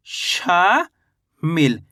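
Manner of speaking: शामिल slowly, शा stretched long, then a pause before मिल; drawn-out शा. Shown as a demonstration. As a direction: break शामिल into a long rising शा and a separate, falling मिल. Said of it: "शा का उच्चारण खींच को रहा है". (शामिल को तोड़कर धीरे बोलें, शा खींचकर, फिर मिल)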